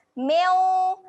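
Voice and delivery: loud "Meu" with a humming nasal. Shown as a demonstration. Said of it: "meu ada bacaan dengung". ("Meu" dengan dengung)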